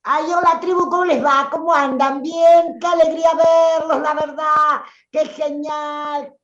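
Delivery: very high, loud, gushy greeting; over-the-top high-pitched excited hello; put-on gushing voice. Shown as a demonstration. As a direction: squeal your delight at seeing everyone. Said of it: "Habla así".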